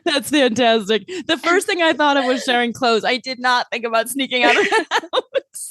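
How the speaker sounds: high laughing delight; laughing loudly while talking. Real, not acted. Amused by sneaking out remark.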